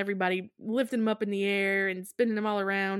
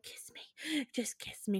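breathless whisper of longing; mock whispered plea; 4 semitones higher, 11 dB quieter than usual. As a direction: whisper it like a dying lover. Undead lover begging, acted.

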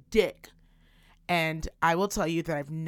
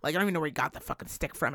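normal talking voice

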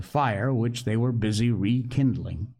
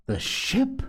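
much higher, startled exclamation; astonished exclamation; 12 semitones higher than usual. A narrator's reading. Doctor hears where the ship is, exclaiming in surprise. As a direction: blurt it out in high-pitched amazement. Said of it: "exclaimed the doctor".

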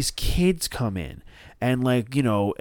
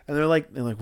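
ordinary delivery

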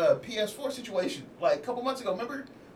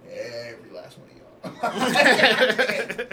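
very slow, louder, laughing; 2 semitones higher, 7 dB louder, much slower than usual. Real, not acted. Telling friends every one of them, laughing slowly. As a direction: drag it out grinning.

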